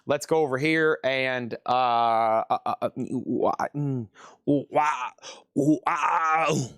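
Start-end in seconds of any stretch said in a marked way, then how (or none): 1.6-6.7 odd wordless grunts and wails. Pretend. Seller mimicking a Disturbed song, wordless ooh-wah vocals. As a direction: imitate the singer's ooh-wah noises.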